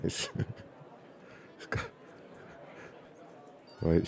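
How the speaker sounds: ordinary delivery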